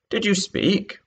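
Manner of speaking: high, prim mouse voice; polite mouse. Acted. The Mouse asking the Lory politely, high prim voice. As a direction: put on a little high polite squeak. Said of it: "said the Mouse, frowning, but very politely".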